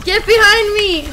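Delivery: slow loud call-out; shouting. Real, not acted. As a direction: yell the order. Calling out in fight.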